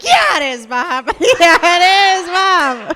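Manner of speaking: loud, high whoops of agreement; hammed-up aroused cheering. Comic answers Bob Ross on the TV, hammed-up ecstatic shouting. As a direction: shout back at the painter with mock ecstasy.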